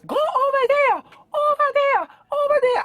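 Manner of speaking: very high, loud, slowed mock-accented yell; imitating the Chinese lady. Restaurant lady in Justin's face ordering him away, high mock shout. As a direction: screech the order like she's in your face. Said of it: "Let me show you. This is exactly how she looked".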